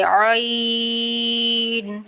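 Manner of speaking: drawn-out pharyngeal عین; from the throat. Shown as a demonstration. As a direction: squeeze the throat on عین and sustain the glide. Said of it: "حلق سے".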